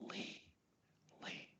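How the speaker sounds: Lee said so softly it barely carries. Shown as a demonstration. Coach shows how to say a feared name, very softly. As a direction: breathe Lee out almost too soft to hear. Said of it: "in a soft voice".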